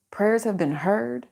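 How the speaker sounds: ordinary delivery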